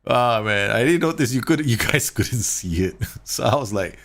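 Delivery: talking through real laughter; giggling at his own blunder; laughing. Real, not acted. Coach laughing at his streaming mistake. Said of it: "laughing so hard now".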